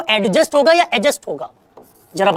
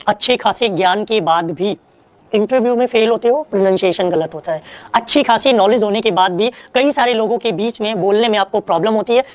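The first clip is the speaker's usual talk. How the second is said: usual speaking voice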